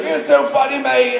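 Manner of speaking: high, hammed-up bad Scottish accent; horrible Scottish accent. Co-star quoting his lines at the wrap party, bad Scottish accent. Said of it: "please ignore my horrible Scottish accent".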